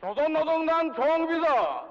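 ordinary delivery

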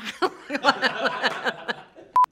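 high loud laughter. Real, not acted. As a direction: crack up laughing. Laughing after the accent.

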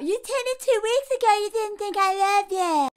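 high-pitched mocking imitation of a boyfriend; Jimmy. Boyfriend defending himself to his partner, mock whine. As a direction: put on a high, whiny voice to mock his excuse. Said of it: "So what does Jimmy do? He pulls out".

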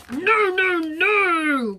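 loud, drawn-out grumpy refusal; thwarted storybook bear protesting; grumbling. Acted. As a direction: grumble each NO in a big voice. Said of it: "grumbled the bear".

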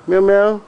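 high, loud mock meow. Owner meowing at his cat, high mock meow.

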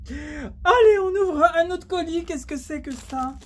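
high, loud and eager, nearly shouting; rally yourself to open the next box; excited shout. Real, not acted. Vlogger opening another parcel on camera, excited shout.

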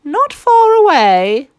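high, loud, slow hen voice; a hen calling out, high and drawn. A narrator's reading. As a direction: chirp it out like an eager hen. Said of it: "chipped in the hen".

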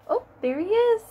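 high, loud delighted "oh, there he is"; excited discovery. Acted. Reading to children, spotting the caterpillar: excited. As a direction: point it out with a bright burst of delight for kids.

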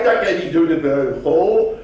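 ordinary delivery

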